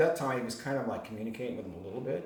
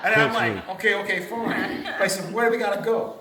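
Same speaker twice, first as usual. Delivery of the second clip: higher and louder, still laughing through it; 10 semitones higher, 11 dB louder than usual. Retold or imitated. Retelling giving in to his friend, laughing. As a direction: keep giggling while quoting yourself.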